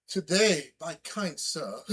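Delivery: high, slow hammed-up gentleman greeting; mock colonial gentleman. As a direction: play a pompous powdered-wig gentleman. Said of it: "powdered wig, all that stuff, just going".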